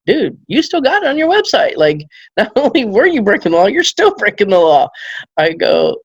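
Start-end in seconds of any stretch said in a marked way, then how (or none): 0.1-4.9 laughing disbelief. Retold or imitated. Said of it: "I'm like".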